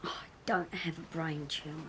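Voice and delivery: low, soft, breath-heavy grumble; weary denial. Acted. Ill partner denying a brain tumour, wearily quiet. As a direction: brush it off under your breath.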